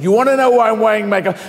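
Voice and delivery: high, fast challenge thrown back; defiant confrontation. Retold or imitated. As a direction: round on your tormentors suddenly. Retold sudden confrontation of the girls.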